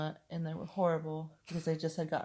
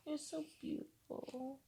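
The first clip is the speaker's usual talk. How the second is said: tender; 9 semitones higher, 11 dB quieter, slower than usual. Real, not acted. Soft affection to partner.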